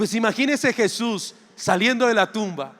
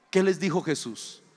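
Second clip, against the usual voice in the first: ordinary talk, nothing marked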